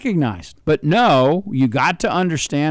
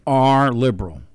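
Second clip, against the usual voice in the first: normal talking voice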